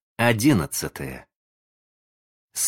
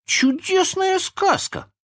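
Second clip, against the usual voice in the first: put-on very high, slow child voice; high-pitched boyish approval; delighted boy; 3 dB louder, slower than usual. A narrator's reading. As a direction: squeak it out with delight. Boy praises the story.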